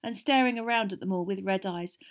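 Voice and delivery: usual speaking voice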